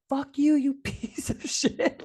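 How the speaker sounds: high, drawn-out mock yell; retold freak-out. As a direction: replay a cursing outburst, half laughing. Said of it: "freak out on him".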